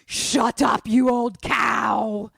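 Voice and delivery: very loud, drawn-out demon roar; hammed-up possessed-child voice; demonic possession voice. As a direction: bellow slowly like a territorial ghost. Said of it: "Janet has demon eyes".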